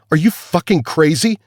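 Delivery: high, loud outburst; angry demand. A narrator's reading. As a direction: hurl the question at him. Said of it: "demanded Paris".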